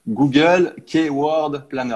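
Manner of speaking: slow, deliberate English accent on "Google Keyword Planner"; "Google Keyword Planner" drawn out slowly in an English accent. Shown as a demonstration. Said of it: "avec mon accent à la con".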